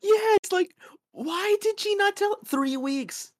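very high, loud exasperated rant; worked up, pitch shooting way up; incredulous indignation. Real, not acted. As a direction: demand an answer in squeaky disbelief. Co-host shares the indignation.

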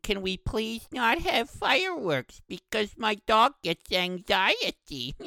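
slow, high-pitched whiny complainer imitation; mock whining. Pet owner complaining on Facebook about fireworks, slow mock whining. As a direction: drag it out as a mocking, pleading whine.

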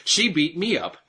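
high whiny claim; mock victim whine. Mocking Tyson's claim.

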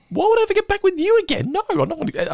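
high indignant woman voice. Retold or imitated. Luann refusing Kirk.

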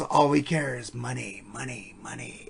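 low, fading devil voice imitation. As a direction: sink down into a devil's growl. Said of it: "devil voice in the song".

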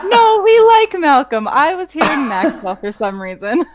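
laughing. Real, not acted. Laughing correction.

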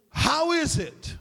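slow, emphatic "How is it"; emphatic question. Shown as a demonstration. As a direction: stretch out "How is it" with high, incredulous emphasis. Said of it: "It's an emphatic in the Greek".

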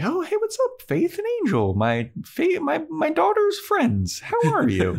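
very high, chirpy mom voice; hammed-up high-pitched welcome to the daughter's friends; over-friendly mom greeting. [name] greets visitors at the door.

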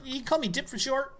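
put-on high eager kid voice; bright little delivery boy; young elf kid. Plays young elf Dipley.

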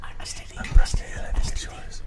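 slow unvoiced whisper. Real, not acted. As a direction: breathe it out slowly. Whispered prompt to caller.

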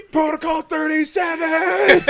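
high, drawn-out loud yell; hammed-up high-pitched command; mock battle order. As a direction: scream the code like a war cry. Imagined kid's rampage.

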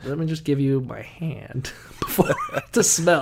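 slow, breathy whisper with a chuckle; cautious whisper. Retold or imitated. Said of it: "and you're like".

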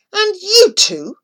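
high, loud angry snap; angry man turning on the boys; snapped. A narrator's reading. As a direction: bite the words off at the boys. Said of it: "he snapped".

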